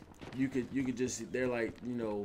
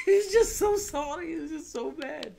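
high laughing outburst, louder; 6 dB louder than usual. Real, not acted. Laughing to viewers about how mad he was. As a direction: break up laughing mid-sentence.

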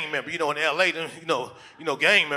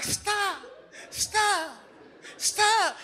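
high-pitched repeated stop, falling each time; mock woman's voice crying stop; woman's high protest; 4 dB quieter, much slower than usual. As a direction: play the woman, squeaky and pleading.

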